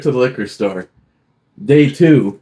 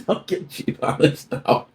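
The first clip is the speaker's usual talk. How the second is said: laughing whisper; 7 dB quieter than usual. Real, not acted. Laughing repeat of line.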